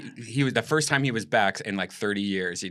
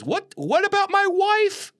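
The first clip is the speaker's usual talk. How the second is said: very high, slow exaggerated comedian voice; drawn-out, high-pitched caricature; Jerry Seinfeld; 4 dB louder, slower than usual. Comedian mishears an audience question, high drawn-out impression. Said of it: "the third time he goes".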